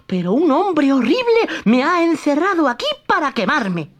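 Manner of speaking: high, innocent puppet voice; Pinocchio lying. Acted. Pinocchio lies to the fairy, high innocent voice.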